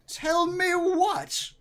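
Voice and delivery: high, loud, drawn-out demand; slow, piercing indignant question; demanding wife. Acted. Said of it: "She said".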